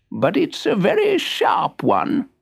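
a hint of a proud old monkey. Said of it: "said the monkey".

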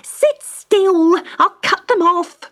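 high, loud, bossy order; urgent command. Acted. Wife Magda loudly ordering Cedric to sit still. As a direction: bark it at him to keep still.

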